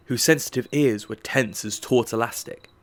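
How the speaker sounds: ordinary delivery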